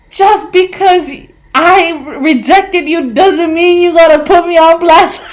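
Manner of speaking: high, loud, slow start, offended shout; retold yell. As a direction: yell it back at him, stung and offended. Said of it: "when he finished yelling".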